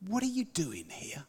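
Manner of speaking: God's question whispered softly; hushed divine whisper. Acted. God speaks to Elijah.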